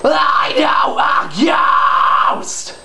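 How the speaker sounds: full yelled metal scream, drawn out and harsh; scream with yelling. Shown as a demonstration. Vocal coach showing how adding the yell sounds better, full yelling scream. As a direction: yell hard through the rasp, stretching every word. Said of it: "So now with yelling".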